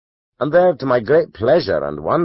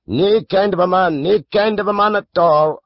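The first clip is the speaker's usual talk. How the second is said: high, scornful gossip's voice; the barber scorns the laird; dismissive barber; 11 semitones higher, 4 dB louder than usual. A narrator's reading. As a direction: wave the man off with contempt.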